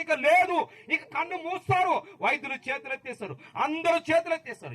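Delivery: high-pitched, sustained sermon cry; fervent preacher at full stretch; impassioned preaching. Real, not acted. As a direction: drive each phrase home on a high pitch.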